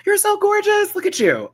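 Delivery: high, loud gasping compliment; gasp of delight. Real, not acted. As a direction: gush over the photo. Gasping at a donor's photo.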